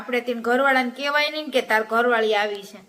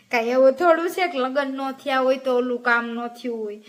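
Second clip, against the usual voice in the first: ordinary talk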